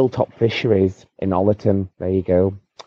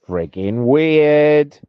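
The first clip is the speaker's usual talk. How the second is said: "friggin' weird" stretched very slowly, high and louder; long, high, exasperated stretch on "friggin' weird"; drawn-out emphasis; 8 semitones higher, 5 dB louder, much slower than usual. Real, not acted. Emphasising how strange meds feel.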